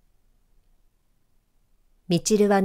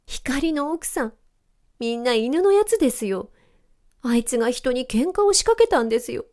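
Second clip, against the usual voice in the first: sobbing complaint; 6 semitones higher than usual. A narrator's reading. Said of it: "しゃくり上げながら目をこすって".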